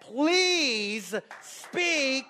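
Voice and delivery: high, impassioned plea to God; pleading prayer. Acted. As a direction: beg the Lord, drawing out the first words. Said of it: "repenting and asking the Lord".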